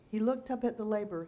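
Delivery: plain reading voice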